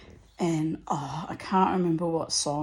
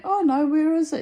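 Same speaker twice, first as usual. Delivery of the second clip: curious; 8 semitones higher, 5 dB louder than usual. Retold or imitated. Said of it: "so I went".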